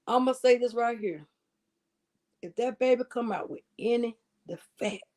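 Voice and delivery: ordinary talk, nothing marked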